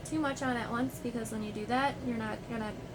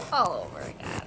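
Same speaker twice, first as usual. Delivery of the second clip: deep groan voice; 11 semitones lower, 7 dB louder than usual. Real, not acted. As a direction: drop way down in frustration. Annoyed at refilming everything.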